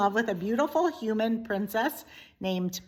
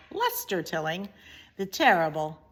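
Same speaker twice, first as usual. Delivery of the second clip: ordinary delivery, nothing marked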